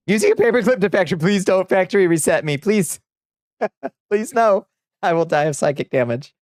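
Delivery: high, playfully panicked begging; mock pleading. Streamer begging chat not to factory reset them, mock pleading.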